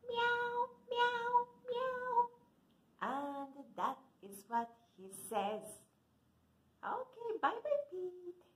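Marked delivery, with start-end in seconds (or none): 0.1-2.3 much higher cute mewing; cat meow. Acted. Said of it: "what does he say". 6.8-8.4 much higher, sweet bye-bye; cute goodbye. Acted. Waving goodbye to cat puppet.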